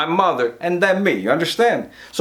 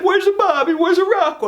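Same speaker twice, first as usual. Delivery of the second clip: high-pitched mock wail; imitated crying mother; 12 semitones higher than usual. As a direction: wail it like a weeping mother, much higher. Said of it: "she starts crying, and she's like".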